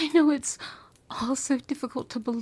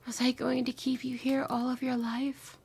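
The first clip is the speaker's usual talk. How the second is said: ordinary delivery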